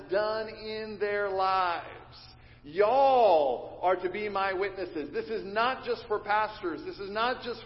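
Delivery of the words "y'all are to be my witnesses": slow, with "Y'all" stressed; preacher's stress on "Y'all"; emphatic y'all. Shown as a demonstration. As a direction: lean hard on "Y'all". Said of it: "repeated emphatic Southern phrase".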